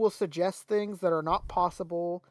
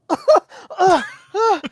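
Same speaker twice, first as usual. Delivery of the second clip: choking sputter; 10 semitones higher, 9 dB louder than usual. Retold or imitated. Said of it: "He's like".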